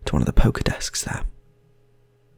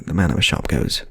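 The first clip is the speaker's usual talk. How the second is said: ordinary talk, nothing marked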